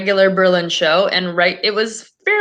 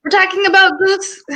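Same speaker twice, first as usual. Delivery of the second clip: laughing; 11 semitones higher than usual. Real, not acted. Cracking up over goose.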